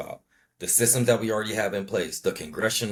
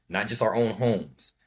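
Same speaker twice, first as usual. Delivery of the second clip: normal talking voice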